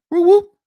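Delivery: high loud whoop of a police siren; siren imitation. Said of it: "hit the fucking siren".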